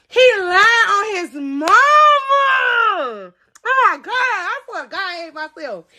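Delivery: laughing despair. Real, not acted. Laughing at own foolishness.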